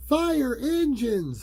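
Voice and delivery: put-on high, slow, loud kid voice; eager classroom answer; child voice. A narrator's reading. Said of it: "said Patty".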